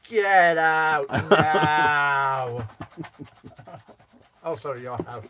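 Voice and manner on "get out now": loud, hugely drawn-out "get out now"; mock order. Jokingly throwing co-host out, mock drawn-out yell. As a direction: stretch every word in a hammed-up yell.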